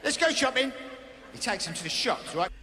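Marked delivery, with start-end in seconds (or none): 0.0-0.7 lightly voiced, a touch higher; brisk host rallying; mock Greg Wallace. Comic as Greg taking charge. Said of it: "He says".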